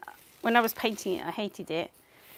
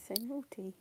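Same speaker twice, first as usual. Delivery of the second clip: whisper; 3 semitones higher, 7 dB quieter than usual. Real, not acted. Fond scolding of her dog.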